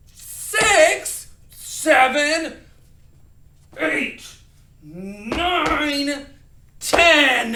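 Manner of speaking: loud, high angry count. Shown as a demonstration. Teacher leading an emotion counting game, angry. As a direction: bellow each number in a temper. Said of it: "we're gonna be angry starting with six".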